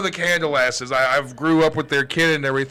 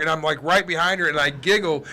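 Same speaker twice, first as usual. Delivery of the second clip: normal talking voice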